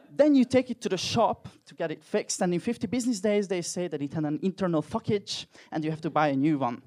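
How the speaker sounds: ordinary talk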